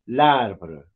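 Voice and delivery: "L'arbre" as one French word, falling at the end; French pronunciation. Shown as a demonstration. Teacher repeats the word for the class, French pronunciation. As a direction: model "L'arbre" alone, article joined to the noun. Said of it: "en francés es l'arbre".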